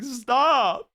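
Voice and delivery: put-on high, squealed kid plea; hammed-up frightened yelp; mock scared protest. Acts out scared kid reaction.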